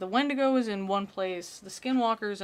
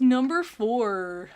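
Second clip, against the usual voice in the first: drawn-out, mocking list-narrator voice; mimicking a list narrator; 2 semitones higher, 5 dB louder, much slower than usual. Mocking a list-video narrator to viewers, exaggerated drawl. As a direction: stretch it out like an annoying countdown host. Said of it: "there's that one guy that goes".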